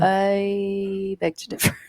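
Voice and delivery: slow, dry and deadpan; dry sarcastic. Retold or imitated. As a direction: say it with dry disbelief. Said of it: "I was like".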